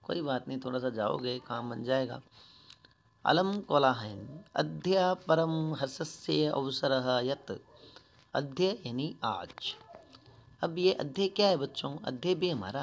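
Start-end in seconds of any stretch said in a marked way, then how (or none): 3.2-7.5 slow, louder recited reading; Sanskrit recitation. Shown as a demonstration. Teacher reading the organiser's Sanskrit line to pupils, louder. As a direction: declaim the line like a text reading. Reads Sanskrit text aloud.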